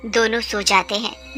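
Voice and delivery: usual speaking voice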